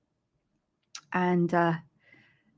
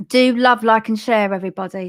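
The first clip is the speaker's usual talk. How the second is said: calling out to viewers; 2 semitones higher, 8 dB louder than usual. Real, not acted. Host urging viewers to share the page, calling out loudly. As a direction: call it out brightly to the whole audience.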